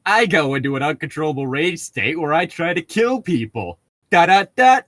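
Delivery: high playful mockery; mock it breezily; mocking sing-song. Real, not acted. Mocking the character.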